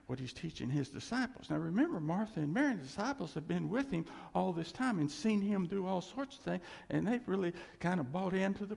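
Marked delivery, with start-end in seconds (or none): none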